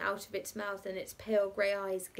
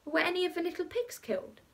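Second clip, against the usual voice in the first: put-on higher child's question; lightly voiced child; anxious child; 8 semitones higher than usual. A narrator's reading. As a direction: ask like a worried little one. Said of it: "child asks about the pigs".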